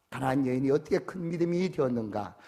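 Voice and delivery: ordinary delivery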